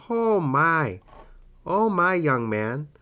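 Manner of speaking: put-on high old lady, dropping at the end. Shown as a demonstration. Old lady addressing a young man, high elderly voice. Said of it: "what kind of accent is that that I'm doing?".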